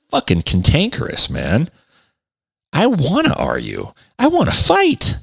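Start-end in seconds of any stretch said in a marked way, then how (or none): 2.7-5.2 high, cartoon-villain squeal of a threat; a hammed-up Skeletor wanting a fight; mock Skeletor. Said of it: "I'm like Skeletor".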